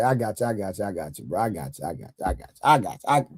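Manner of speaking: normal talking voice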